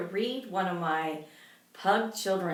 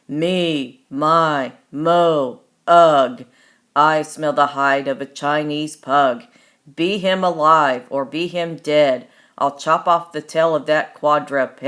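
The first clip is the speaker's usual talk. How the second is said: giant chant, louder with each word; booming giant; 5 semitones lower, 12 dB louder than usual. Acted. Hungry giant sniffing out the hidden pug, booming. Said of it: "The giant's voice boomed".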